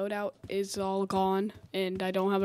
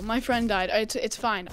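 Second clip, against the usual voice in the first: normal talking voice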